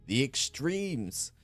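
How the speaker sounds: loud, high ring announcer. Acted. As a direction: hype up the crowd. Announcer introduces the wrestlers.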